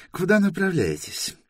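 high official's question; interrogating inspector. Acted. Inspector asks Торрен where they come from, officious.

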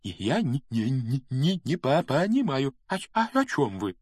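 high, halting stutter on first syllables; nervous stammer. A narrator's reading. Quirrell answering Snape in the dark forest, stammering nervously. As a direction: trip anxiously over the consonants. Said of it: "к заикающемуся профессору".

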